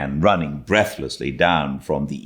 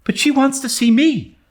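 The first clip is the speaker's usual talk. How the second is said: put-on high, louder girl's plea; pleading Anne; 4 dB louder than usual. A narrator's reading. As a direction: beg like an eager young girl. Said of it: "pleaded Anne".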